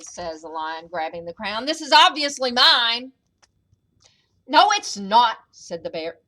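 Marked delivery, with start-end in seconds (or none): 1.7-3.1 boastful lion claim. A narrator's reading. Lion claims crown in quarrel. 4.5-5.4 arguing bear. A narrator's reading. Bear argues over crown.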